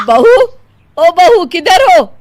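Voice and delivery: loud, high, stretched call. Acted. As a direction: holler through the house for someone. Mother-in-law calls for her. (ज़ोर से आवाज़ लगाना)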